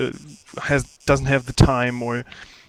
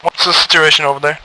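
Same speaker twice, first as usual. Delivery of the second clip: loud fast radio query; radio call; 3 semitones higher, 9 dB louder, faster than usual. Acted. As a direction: demand a status over the radio. Leader asking on radio.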